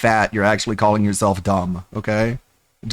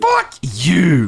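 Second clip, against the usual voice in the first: mock angry shout; 6 semitones higher than usual. Mocking the raging gamer.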